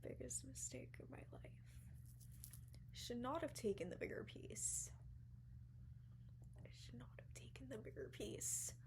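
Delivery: hushed regretful whisper. Real, not acted. Admitting her big mistake to viewers, whispered. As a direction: breathe it out like a confession.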